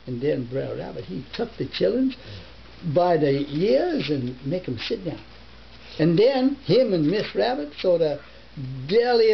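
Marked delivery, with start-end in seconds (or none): none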